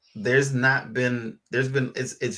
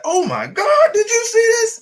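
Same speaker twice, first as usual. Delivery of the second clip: much higher and louder, near squeal; excited reading; 10 dB louder than usual. Retold or imitated. Wife thrilled by a Bible verse, loud high squeal. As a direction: gasp it out like a thrilling discovery. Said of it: "You can read it and be like".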